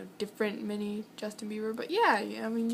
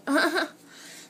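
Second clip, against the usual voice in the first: burst of laughter; 6 semitones higher, 6 dB louder than usual. Real, not acted. Laughs after dropped ice.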